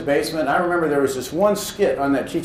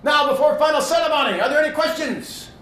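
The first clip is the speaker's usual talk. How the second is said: higher put-on commander voice, shouting the orders; Japanese commander; 9 semitones higher than usual. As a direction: play the Japanese commander from the old war movie, voice pitched up. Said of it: "Japanese commander go".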